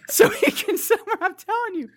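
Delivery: high, laughter-choked reading; breaking up laughing. Real, not acted. Host reading review to co-host, breaking up laughing. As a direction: try to read through the giggles.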